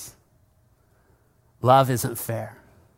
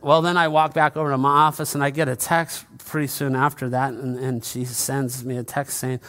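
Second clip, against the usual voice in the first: ordinary talk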